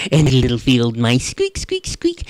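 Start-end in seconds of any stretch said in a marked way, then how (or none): 1.3-2.3 high squeaky mouse noises; mouse squeaks. Acted. Field mice answer rat.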